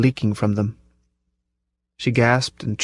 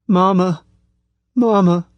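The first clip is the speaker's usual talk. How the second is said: put-on high, loud childlike calls; rising, desperate cries of Mama; crying out for mama; 7 semitones higher, 3 dB louder than usual. A narrator's reading. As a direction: call for mother like a terrified child. Sobbing woman regresses, calls mother.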